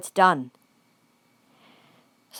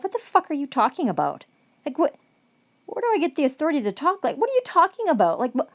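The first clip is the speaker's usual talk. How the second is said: incredulous disbelief; 6 semitones higher, faster than usual. Retold or imitated. Reliving her stunned reaction in court, climbing incredulous questions. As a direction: pitch climbing higher with each question. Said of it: "it fucking turned me inside out".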